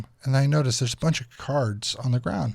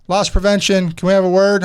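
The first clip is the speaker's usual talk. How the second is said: louder and higher, a staff member calling through a door; 8 semitones higher, 9 dB louder than usual. Retold or imitated. Store security calling at the bathroom, loud calling out.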